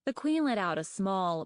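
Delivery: ordinary delivery, nothing marked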